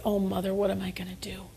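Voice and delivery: tearful whispered plea. Retold or imitated. Daughter to mother after crying, whispered plea. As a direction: breathe it out, near tears. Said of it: "And I said".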